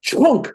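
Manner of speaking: high punchy "Chunk!"; imitated shock sound. Describing his soul getting defibrillated, punchy shock sound. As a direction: hit it like a jolt of current.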